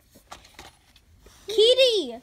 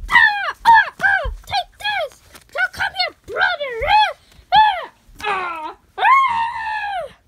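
much higher, loud battle screams; fight screams; 13 semitones higher, 9 dB louder, slower than usual. Acted. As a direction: scream through the toy fight.

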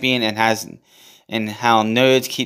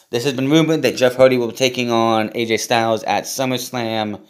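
ordinary talk, nothing marked

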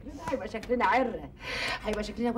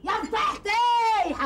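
much higher, louder and slow, "فضيحتي" wailed out; shouted lament; 10 semitones higher, 6 dB louder, much slower than usual. Acted. Woman screaming at her disgrace. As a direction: burst out "يا فضيحتي" as a drawn-out scream.

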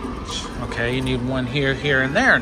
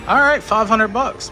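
high, loud excited cheer; shrieking jackpot winner; 8 semitones higher, 4 dB louder than usual. Real, not acted. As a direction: cheer the big win. Big slot win lands.

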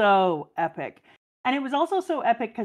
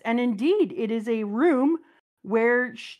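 usual speaking voice

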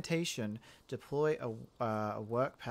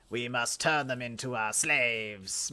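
hammy evil villain voice, slow; mad scientist; slower than usual. Retold or imitated. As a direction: play the greedy overlord. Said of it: "people are probably gonna go".

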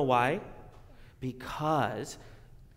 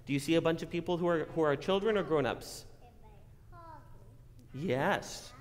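normal talking voice

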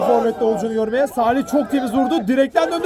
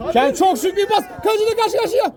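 excited commentary; 6 semitones higher, faster than usual. Real, not acted. Attack building to chance.

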